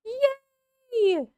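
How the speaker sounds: high cheer of joy; squealing yay. Real, not acted. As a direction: cheer like a delighted fan. Cheering at announcement.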